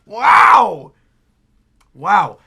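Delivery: loud, drawn-out wow; loud awestruck exclamation; amazed wow. Real, not acted. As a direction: let out a big stretched wow. Recalling the amazing moment.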